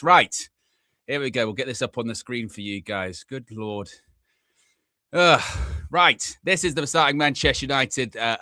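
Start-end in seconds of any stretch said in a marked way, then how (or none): none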